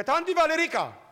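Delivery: high, loud excited call; little brother shouting the healing news; child calling out. Retold or imitated. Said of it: "strigă".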